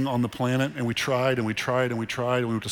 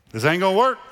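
high, louder joking delivery building to a laugh; playful punchline; 5 semitones higher, 4 dB louder, faster than usual. Real, not acted. Pastor joking about his wife and travel, playfully high. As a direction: tease the crowd with a cheeky aside.

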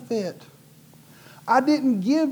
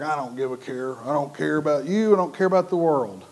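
imitating indifference; 5 semitones lower than usual. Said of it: "he just acted like".

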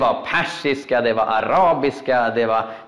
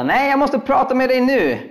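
insistent plea; 10 semitones higher than usual. Retold or imitated. Insisting to Majken.

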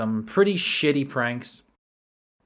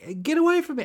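high-pitched mimicked plea; mock alarmed protest; much faster than usual. Beachgoer fending off the prankster, high mock alarm. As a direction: fend him off in a squeaky imitated voice. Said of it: "they'd go like".

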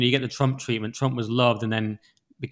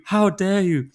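higher, mock-indignant elderly voice; Greta Thunberg; 6 semitones higher, slower than usual. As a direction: mimic her indignant catchphrase like an old lady. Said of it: "She sounds like sixty, seventy years old".

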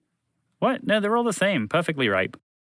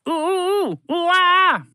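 high, loud monkey hoots rising to a screech; ooh-ooh-ah chimp call, much higher and louder; monkey grunts; 5 dB louder, much slower than usual. A narrator's reading. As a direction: hoot like an angry ape.

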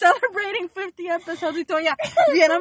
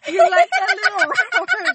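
teasing laugh; 11 semitones higher, 5 dB louder than usual. Real, not acted.

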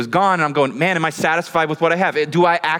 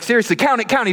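high, urgent imitated hype; egging on; 9 semitones higher than usual. YouTuber urging the man to count money, high excited egging-on. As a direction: push him to get worked up. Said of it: "trying to get me worked up".

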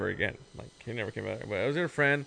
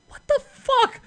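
much higher and louder, stunned; a squeaky, stunned outcry; shocked what; 7 dB louder, slower than usual. Retold or imitated. Retold shock at her denial.

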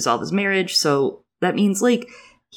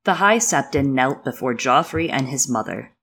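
usual speaking voice

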